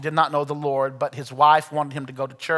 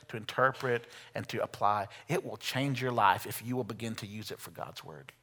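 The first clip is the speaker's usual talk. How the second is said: softer, intimate closing appeal; soft altar call; 3 semitones lower, 7 dB quieter than usual. Acted. Pastor inviting listeners to commit, speaking softer. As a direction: draw them in quietly before the prayer.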